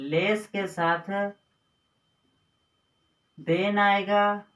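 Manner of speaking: slow, deliberate teacher emphasis; patient classroom dictation; slow rule dictation. Shown as a demonstration. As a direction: spell the rule out slowly for students. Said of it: "इसी rule को भी याद रखना है".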